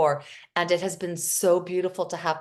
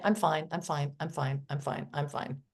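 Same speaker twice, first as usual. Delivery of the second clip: lower, softer, fading rote "I'm fine"; automatic brush-off; 6 semitones lower, 7 dB quieter than usual. Retold or imitated. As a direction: fade out like a robot on repeat. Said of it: "kind of automatic saying".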